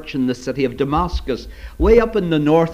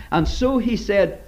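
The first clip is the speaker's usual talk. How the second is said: ordinary delivery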